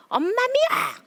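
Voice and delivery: put-on high, loud childish yell; child's shout. Four-year-old yelling at mom, loud high shout.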